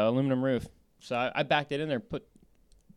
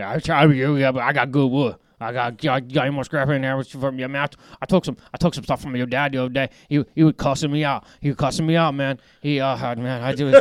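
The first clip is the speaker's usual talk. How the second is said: doing the bayou scrap guy, louder and Cajun-flavored; bayou accent; 5 dB louder than usual. Retold or imitated. Mimicking the Cajun scrap guy to friends, loud bayou accent. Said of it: "this guy sounds like he's from the bayou".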